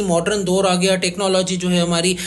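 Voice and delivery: ordinary delivery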